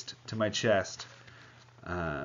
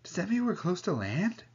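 eager; 10 semitones higher than usual. Acted. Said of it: "with delight".

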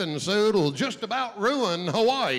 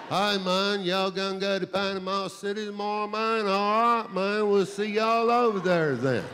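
put-on drawn-together North Florida stoner accent; laid-back pothead with a North Florida accent. Comic showing the crowd a North Florida accent, pothead style. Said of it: "you get the North Florida accent. It's sort of the [name], [name] pothead thing".

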